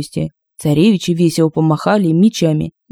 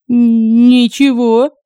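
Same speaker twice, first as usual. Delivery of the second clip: higher, stammered denial; frightened gasp; 6 semitones higher, 4 dB louder, much slower than usual. Acted. Frightened woman denying she sees the cat, stammering high. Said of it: "выдохнула она".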